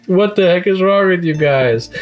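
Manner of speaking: high, baffled outburst; exasperated Kyo. Retold or imitated. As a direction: yell at everyone who fell for it. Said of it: "He's like".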